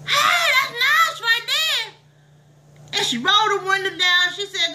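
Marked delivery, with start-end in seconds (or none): 0.1-1.9 high, drawn-out holler of admiration; excited call-out. Acted. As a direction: call out to the neighbor, thrilled and slow. Admiring the neighbor's new car.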